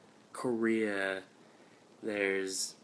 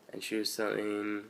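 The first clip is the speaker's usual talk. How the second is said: ordinary talk, nothing marked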